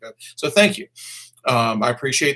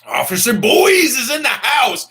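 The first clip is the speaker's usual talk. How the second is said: hype announcement; 9 semitones higher, 5 dB louder than usual. Pretend. Announcing a viewer's arrival.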